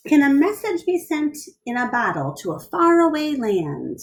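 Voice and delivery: lively storybook read-aloud; bright picture-book reading; reading to children. A narrator's reading. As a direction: read it to a class of kids.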